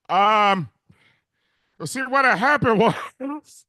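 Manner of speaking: usual speaking voice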